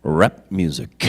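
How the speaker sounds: low, slow, dead-serious imitation of the co-star; flat, grave Vulcan pronouncement; deadpan Vulcan impression. Imitating the co-star's stern answer to his joke, low and slow. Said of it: "he's like this".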